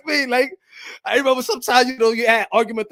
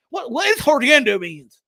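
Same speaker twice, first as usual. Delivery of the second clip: ordinary delivery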